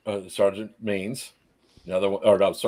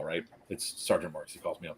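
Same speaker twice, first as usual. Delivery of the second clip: ordinary talk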